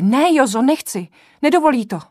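high, loud frantic refusal; desperate protest. Acted. Sick woman pleading with Joza not to fetch a doctor, high loud protest. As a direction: beg him not to, voice strained upward.

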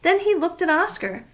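plain reading voice

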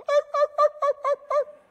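rapid very high, loud yelps; imitated frightened dog. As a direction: yelp like a fleeing dog. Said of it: "And the dog go".

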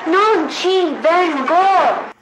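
imitating the film line. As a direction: drag every word out like the actress.

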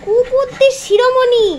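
slow, lifted mock-solemn praise; a hint of a pompous herald; mock grand title. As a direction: crown the dog king of dogs, tongue in cheek.

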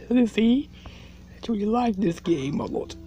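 normal talking voice

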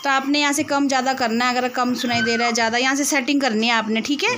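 loud and high so a deaf ear catches it. Real, not acted. To the hard-of-hearing uncle, loud and high. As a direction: call out clearly to someone hard of hearing. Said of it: "ज़ोर ज़ोर से चिल्ला चिल्ला के बोलना पड़ता है".

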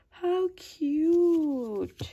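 high, loud swooping coo; real gushing delight. Real, not acted. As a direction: coo it loudly, falling away at the end. Delighted on seeing gift.

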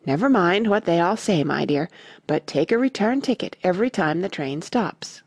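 plain reading voice

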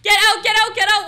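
loud repeated yell; shouting. Acted. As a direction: bark them out of the house. Ordering intruders out.

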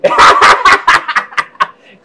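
high, loud peal of laughter. Acted. Man cracks up at his own story, loud laughter. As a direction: burst out laughing hard.

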